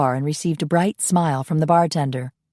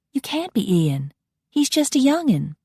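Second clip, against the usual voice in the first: rising to a much higher incredulous pitch; disbelieving Southern belle; 8 semitones higher than usual. Acted. Coralie refusing to believe he is Ian, pitch climbing high. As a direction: scoff in disbelief.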